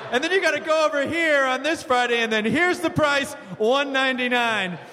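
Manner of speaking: high-voiced sales patter; mock presenter voice. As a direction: imitate a chirpy host pointing out prices. Acting Matt presenting on air.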